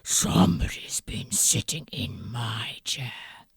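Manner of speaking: low whispered gruff bear; deep hushed papa bear; Daddy Bear. Acted. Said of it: "And Daddy Bear said".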